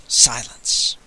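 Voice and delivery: ordinary delivery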